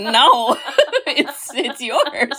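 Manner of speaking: giggling through the words; laughing. Real, not acted. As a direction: laugh as you say it. Laughing while retelling.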